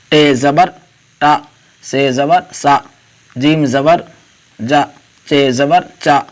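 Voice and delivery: each letter name, then zabar, then the vowel sound, spaced out; letter-by-letter drill. Shown as a demonstration. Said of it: "آج کا سبق شروع کرتے ہیں".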